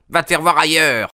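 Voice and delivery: high, loud angry brush-off; angry dismissal. A narrator's reading. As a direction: shout him away. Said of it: "répondit la voix".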